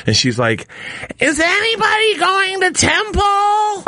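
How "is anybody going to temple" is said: high, slow, wailing whine; real whiny. Retold or imitated. Friend Stacy in the dream, wailing to the office. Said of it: "Like, real whiny".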